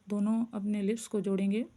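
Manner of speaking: ordinary delivery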